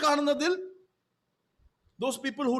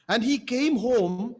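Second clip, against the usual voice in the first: scripture reading; 4 semitones lower, slower than usual. Acted. Reads scripture aloud.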